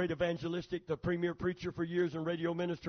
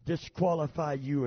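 ordinary delivery

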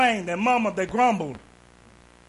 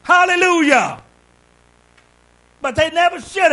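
preaching at full force; 8 semitones higher, 7 dB louder than usual. Acted. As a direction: build to a shout of praise. Sermon climax.

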